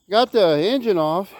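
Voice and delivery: winded, pushing the words out; out of breath. Real, not acted. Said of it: "Sorry I'm out of breath".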